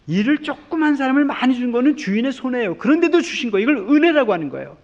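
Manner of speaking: high and loud, swelling on grace; fervent sermon peak; impassioned preaching. Acted. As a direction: proclaim grace from the pulpit. Preaching at full force.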